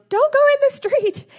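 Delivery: very high, loud shout; yelled warning. Retold or imitated. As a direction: yell it after the running kids. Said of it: "and I yelled".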